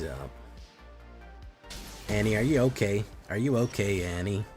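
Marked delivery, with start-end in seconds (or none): none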